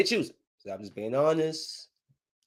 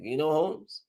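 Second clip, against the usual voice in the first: put-on Mexican accent. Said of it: "I can see the Mexican like".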